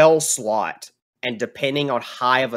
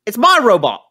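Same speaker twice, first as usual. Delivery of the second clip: high, loud, furious little dwarf; squeaky enraged dwarf yelling from below; angry dwarf; 8 semitones higher, 7 dB louder, faster than usual. Acted. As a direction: bark it up at him like a pint-sized brawler. Dwarf guards his robot.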